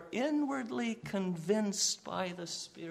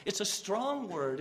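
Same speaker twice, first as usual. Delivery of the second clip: ordinary delivery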